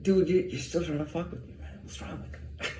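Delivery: dropping to a near whisper on the last question; muttered aside. Retold or imitated. Speaker complaining about rivals who keep messing with him, muttered under breath. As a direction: say it under your breath, fed up. Venting at rivals, voice drops away.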